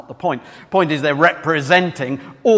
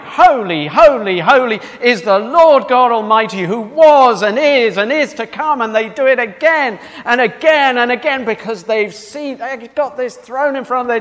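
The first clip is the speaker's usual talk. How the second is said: high, loud, rapturous proclamation; bowled-over praise; 7 semitones higher, 5 dB louder than usual. Acted. Preacher voicing heaven's creatures praising non-stop, bowled over. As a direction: pour out praise, overflowing with awe. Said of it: "they're just bowled over by it".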